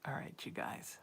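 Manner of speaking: hushed whisper to viewers. Real, not acted. Streamer whispering to her viewers, hushed whisper. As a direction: keep it to a breathy whisper.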